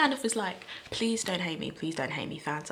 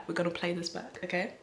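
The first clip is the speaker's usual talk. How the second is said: ordinary talk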